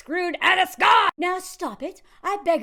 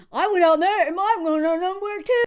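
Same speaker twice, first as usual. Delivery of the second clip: mocking dopey roommate voice, louder; 5 semitones higher, 8 dB louder than usual. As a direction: ham up the clueless roommate.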